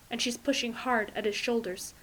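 ordinary delivery, nothing marked

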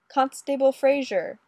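put-on loud, high accented call. A narrator's reading. Olga banging on Fraser's door, calling out. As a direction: call sharply through a closed door. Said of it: "Olga called, banging on the door".

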